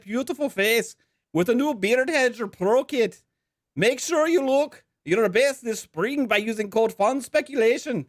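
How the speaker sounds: high-pitched, hammed-up Irish accent sponsor read. Podcast host reads the Saint Patrick's Day sponsor ad in a hammed-up Irish accent. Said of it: "we're gonna be reading this with an Irish accent".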